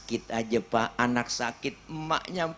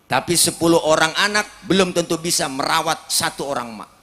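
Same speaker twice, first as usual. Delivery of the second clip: preaching louder; 2 semitones higher, 8 dB louder than usual. Acted. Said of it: "preacher repeats the saying for emphasis".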